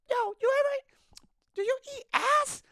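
very high falsetto impersonation; Lewis. Retold or imitated. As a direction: imitate a coworker with a really high-pitched voice. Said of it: "a really high-pitched voice".

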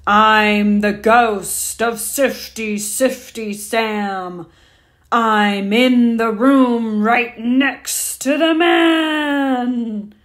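slow, loud booming ghost voice; roaring ghost. A narrator's reading. Ghost roaring at Dan from the dark, slow and booming. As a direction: boom it out slowly like a hungry ghost. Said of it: "and roared at Dan".

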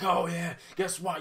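high, loud mock yell of a rowdy fan. Imitating rowdy hockey fans at the game, loud mock yell. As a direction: bellow it like a drunk fan at the rink.

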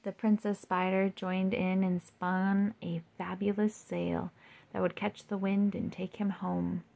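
plain reading voice

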